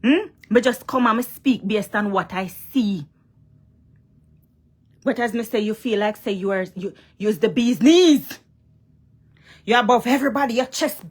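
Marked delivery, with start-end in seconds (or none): none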